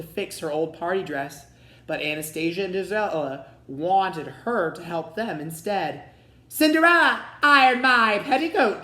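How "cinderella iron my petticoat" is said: much higher, louder, bratty command; bossy order. A narrator's reading. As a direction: yell orders like a spoiled stepsister. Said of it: "they ordered".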